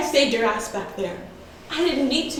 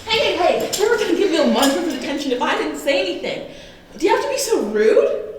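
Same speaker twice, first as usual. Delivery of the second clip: higher, heated scolding; exasperated protest, pitched up; indignant objection; 5 semitones higher, 4 dB louder than usual. Acted. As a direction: cut in sharply with raised voice, affronted. Jury head objects to her rudeness.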